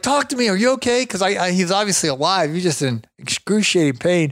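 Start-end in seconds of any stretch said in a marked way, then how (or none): 0.0-1.1 high, urgent worried plea. Retold or imitated. To his injured friend, a high worried plea. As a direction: press him anxiously for an answer.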